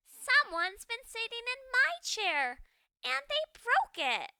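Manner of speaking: high, loud upset little ghost; baby ghost. Acted. Baby ghost finds broken chair, high upset wail. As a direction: wail it like a hurt little kid.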